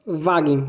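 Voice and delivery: "Wagen" with a hard g, VAH-gen; German Wagen. Shown as a demonstration. Teacher modelling the German word for car. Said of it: "Um exemplo é a palavra Wagen".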